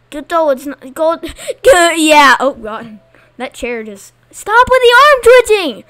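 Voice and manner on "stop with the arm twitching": exasperated yell. Real, not acted. Annoyed at players.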